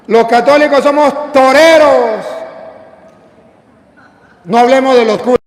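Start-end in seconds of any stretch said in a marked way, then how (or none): none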